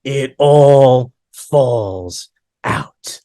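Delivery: very slow, drawn-out doom; imitated scary rabbi. Scary rabbi in a student's face, slow mock menace. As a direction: intone it word by word, looming. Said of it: "him getting into my face going".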